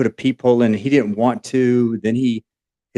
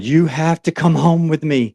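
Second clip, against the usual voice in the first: pitched higher, pressing and urgent; passionate plea; 7 semitones higher than usual. Retold or imitated. On the train, she pleads with the stranger passionately. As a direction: push the plea up, insistent. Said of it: "she starts getting more passionate about it".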